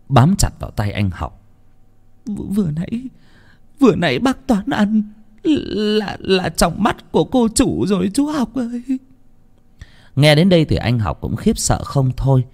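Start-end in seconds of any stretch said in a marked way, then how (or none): 2.3-8.8 high, stammering, horrified maid; trembling with fear. Acted. Maid telling Học what Toán ate, trembling with fear. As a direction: stammer it out high-pitched in terror. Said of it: "Cô Thơm run rẩy".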